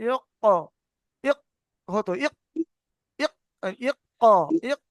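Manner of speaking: "ইক" stopped short, then a separate release; short, caught "ইক"; jolted sakin stop. Shown as a demonstration. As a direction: jolt each "ইক" and pause on the catch. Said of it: "ঝাটকাকে, ঝাটকা হবে".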